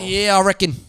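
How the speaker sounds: laid-back mock Aussie sign-off; put-on Australian agreement; Australian accent. [name] rounds off his snake bit in a mock-Australian accent. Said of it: "You're good at accents".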